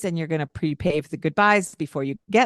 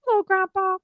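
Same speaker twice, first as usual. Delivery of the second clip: hello grandpa jumped way up high; playful squeaky hello. Shown as a demonstration. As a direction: play it silly with a squeaky greeting. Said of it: "bring in some humor and some playfulness".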